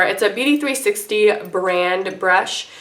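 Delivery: ordinary delivery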